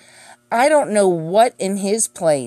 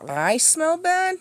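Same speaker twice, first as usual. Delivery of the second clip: high, slow, drawn-out disbelief; mock disbelief; 10 semitones higher, 3 dB quieter, slower than usual. Real, not acted. Mocking the boyfriend's claim, in a high, slow mock-disbelieving voice. Mocking his accusation again.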